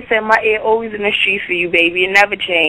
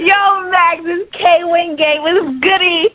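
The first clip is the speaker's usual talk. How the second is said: excited shout; 7 semitones higher, slower than usual. Real, not acted. Excited caller greeting Max.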